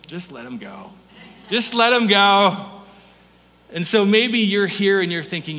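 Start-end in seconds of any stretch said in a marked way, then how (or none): 0.1-0.9 soft aside. Acted. John teasing the others about Peter, quiet aside. 1.5-2.7 louder and slower, a firm exasperated repeat. Acted. John teasing the others about Peter, slow louder repeat.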